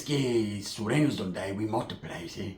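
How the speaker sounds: low, soft, slow gangster voice; imitating Pepe. Pepe about the shooting, low slow mock gangster. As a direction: drag it out, low and cold. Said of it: "He says".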